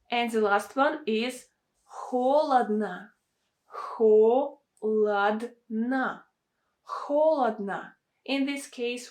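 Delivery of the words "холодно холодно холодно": "холодно" as HO-lad-na, slow, once split into syllables; long strong HO in "холодно", other O's as A; stress on first syllable. Shown as a demonstration. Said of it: "stress on the first syllable".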